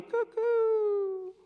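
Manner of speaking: high, drawn-out cock-a-doodle cry; imitated rooster crow. Preacher marks Peter's third denial, high mock rooster crow. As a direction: mimic a crowing bird, high.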